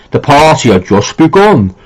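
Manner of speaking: slow, exaggerated horror-actor impression; Boris Karloff. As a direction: draw it out with swooping pitch, hammy and theatrical. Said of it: "Awful Boris Karloff impression".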